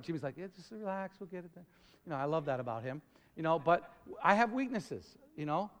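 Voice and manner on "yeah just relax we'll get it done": soft, unbothered reassurance, much softer; a hint of easygoing Jimmy in a storm; calm and quiet. Jimmy calming the panicking speaker during a storm, softly. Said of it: "he's very calm".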